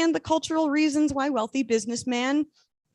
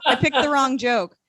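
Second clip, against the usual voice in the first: usual speaking voice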